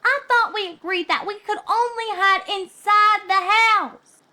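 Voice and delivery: put-on high, light young pony girl voice; a playful filly teasing her friend. A narrator's reading. Scootaloo teasing Sweetie Belle, high filly voice.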